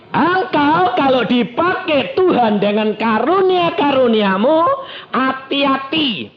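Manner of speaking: high, emphatic exhortation; full-force preaching. Real, not acted. Preacher urging the congregation to read Scripture, full-force preaching. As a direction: drive each point home from the pulpit.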